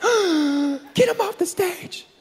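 gasp, then high, slow mock outrage; shocked gasper. Preacher mimics gaspers in the crowd, mock-shocked. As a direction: play an appalled audience member, high-pitched. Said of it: "there's always some gaspers in the crowd".

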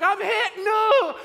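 high, very loud mock war-movie cry; hammed-up yell. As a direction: yell it like a soldier dying in a war film. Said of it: "'cause he yells".

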